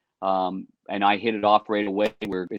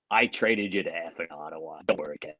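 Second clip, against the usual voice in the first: imitating the coach; 4 semitones lower, 7 dB quieter than usual. Coach voiced in retold story.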